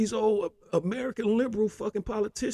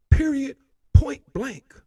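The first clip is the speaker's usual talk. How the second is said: louder, punchy final verdict; emphatic shout; 5 semitones higher, 10 dB louder, slower than usual. Real, not acted. Ranting commentator to Zelensky, a loud emphatic verdict. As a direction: slam each word down like a gavel.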